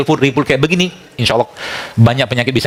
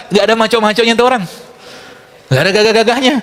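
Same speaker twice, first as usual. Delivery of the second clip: mocking; 8 semitones higher than usual. Mocking weak modern men.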